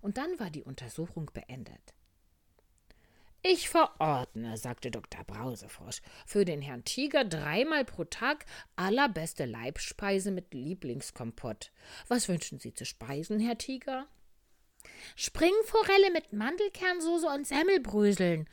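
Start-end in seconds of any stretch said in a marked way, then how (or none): 3.4-4.6 slow, loud, pompous prescription; doctor's pronouncement. Acted. 6.3-14.1 loud, lilting doctor voice, pitched up; doctor frog prescribing. Acted. 15.2-18.5 put-on very high, loud, childlike tiger; little tiger calling out. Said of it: "rief der kleine Tiger".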